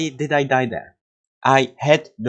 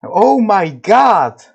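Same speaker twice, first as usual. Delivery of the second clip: slow, much higher, louder cry; overjoyed; 7 semitones higher, 6 dB louder, slower than usual. Real, not acted. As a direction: let the joy burst out slowly. Sudden huge progress run.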